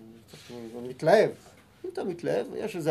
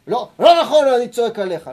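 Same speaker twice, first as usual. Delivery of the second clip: much louder, higher, hammered "לא, לא נכון, אני צועק עליך"; shouting at a study partner; 5 semitones higher, 10 dB louder than usual. Shown as a demonstration. Rabbi acts a shouter at his study partner, loud. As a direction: bark it at your partner. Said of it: "אתה שמח ממה שרשי אומר".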